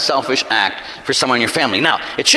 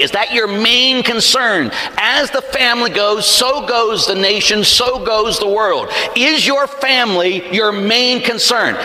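higher-pitched, insistent sermon delivery; impassioned preaching; 6 semitones higher than usual. Real, not acted. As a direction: drive each point home to the congregation. Preacher presses the congregation.

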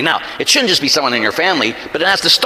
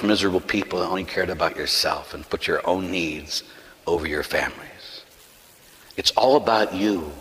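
low, hushed sermon appeal; solemn preaching; 8 semitones lower, 6 dB quieter than usual. Real, not acted. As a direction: let the voice sink low and soft as you appeal to the congregation.

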